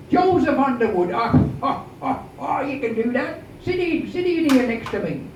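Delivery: put-on a tipsy old salt hailing a friend; drunk captain.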